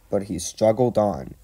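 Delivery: usual speaking voice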